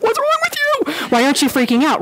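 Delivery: high, baffled mock outburst; imitating a disbelieving friend; incredulous. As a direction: act stunned that nobody is panicking. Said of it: "you're just like".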